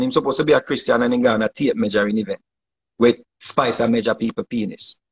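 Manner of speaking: normal talking voice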